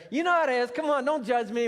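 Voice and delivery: loud, high, playful pleading; mock plea. Real, not acted. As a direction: beg the crowd not to judge. Pleading with audience for sympathy.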